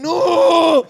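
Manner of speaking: long high yelled no; hammed-up desperate wail; retold scream. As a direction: cry out to stop them, loud and high. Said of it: "Y le iba a pegar y dije".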